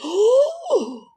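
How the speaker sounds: shocked "oh" as it all clicks; stunned realisation. Retold or imitated. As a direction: let the penny drop all at once. Sudden realisation.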